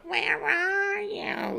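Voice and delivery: high, slow, whiny calling voice; Shelley Winters whine. Retold or imitated. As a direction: drag it out in a whiny call. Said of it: "I can't do Shelley Winters, ... that whiny voice".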